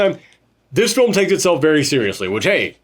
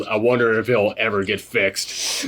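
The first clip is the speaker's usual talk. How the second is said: put-on weary old man; 6 semitones lower, 5 dB quieter than usual. Host quoting Akio's bitter line, low and quiet. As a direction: sigh it out low like a tired veteran. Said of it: "turns halfway to the camera and goes".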